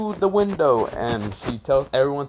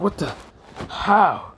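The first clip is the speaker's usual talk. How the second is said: shocked; 8 semitones higher, slower than usual. Acted. Orochimaru exposed, stunned.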